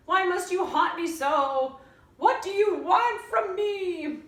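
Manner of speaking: granny moaning. A narrator's reading. Grandmother moaning to her granddaughter, high pained wail. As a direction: stretch it out like a suffering granny. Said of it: "Granny moaned".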